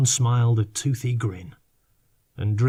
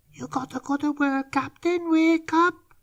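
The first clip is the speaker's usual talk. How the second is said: much higher, soppy little creature voice; soppy creature whisper. Acted. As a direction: coax him awake, soppy and sweet. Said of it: "whispered in his own soppy way".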